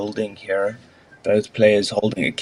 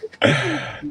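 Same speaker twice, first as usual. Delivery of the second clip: breathy laugh. Real, not acted. Laughs at the excuse.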